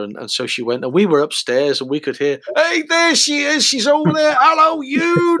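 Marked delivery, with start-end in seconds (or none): 2.6-5.4 very high, slow fan calls; excited fans calling. Retold or imitated. Fans downstairs spotting Eunice, calling out in very high, slow voices. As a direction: call out like starstruck fans from downstairs.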